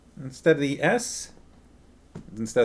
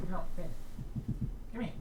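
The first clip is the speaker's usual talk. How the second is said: high, soft scolding of the dog; a gentle high no to the pet; pet-talk voice; 7 semitones higher, 9 dB quieter, much faster than usual. Real, not acted. Owner softly calling Ben back in high pet talk.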